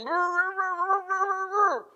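high, muffled mumbling through grave clothes; mummified Lazarus mumbling. Pretend. Preacher imagining Lazarus bound in grave clothes, muffled high mumble. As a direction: mumble like a man wrapped up tight. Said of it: "I can imagine him going".

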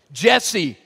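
ordinary delivery

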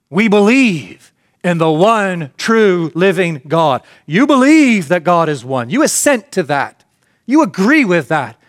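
ordinary talk

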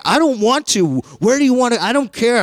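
high, loud whine; whiny refusing kid. Retold or imitated. Boy refuses to pick a restaurant, high whine. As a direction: whine it like a stubborn child.

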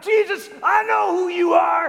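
high, loud shout of an unclean spirit; calling out. Acted. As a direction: shout it out in full demonic challenge. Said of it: "they're calling out".

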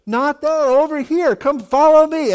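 high, loud mock guiding call; calling out directions. Elisha steering blinded soldiers who grope around, high playful calling out.

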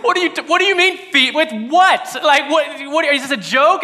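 a disciple blurting out a baffled protest; baffled disbelief. Retold or imitated. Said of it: "they went".

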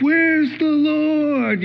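high, long drawn-out call; mock-distressed, stretched-out cry; searching call. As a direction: call out slowly for someone missing. Acts disciples searching in the storm.

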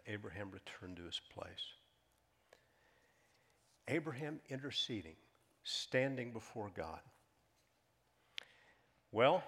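ordinary talk, nothing marked

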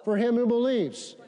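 slow call for response. Shown as a demonstration. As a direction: draw out "is possible" very slowly for the church to repeat. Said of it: "say everything".

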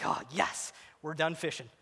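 low, soft private celebration; quiet relief. Retold or imitated. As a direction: breathe it out to yourself, relieved. Relieved after the catch.

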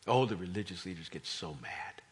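usual speaking voice

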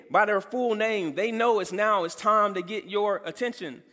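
ordinary talk

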